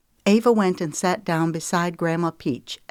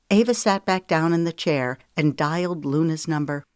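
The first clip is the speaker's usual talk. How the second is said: ordinary delivery, nothing marked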